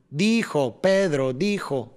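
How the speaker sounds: "dijo, Pedro dijo" slowly, beat by beat; each word set on a beat, first syllables accented; measured, slow rhythm on "Pedro dijo"; rhythmic demo. Shown as a demonstration. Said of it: "ahí empieza ahí la rítmica".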